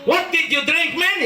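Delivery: high put-on American question; mock American asking. American man asking what he ate, high put-on accent. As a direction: quiz him casually, American style.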